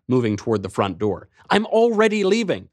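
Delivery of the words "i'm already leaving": higher, louder insistence; shouting. Acted. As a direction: keep shouting, already halfway out. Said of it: "I shouted".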